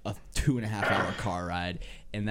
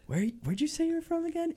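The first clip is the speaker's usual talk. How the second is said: high-pitched, puzzled prince; Prince Eric. Acted. Prince questions mermaid about her home, high and puzzled. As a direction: ask in a high put-on voice. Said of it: "Prince Eric is like".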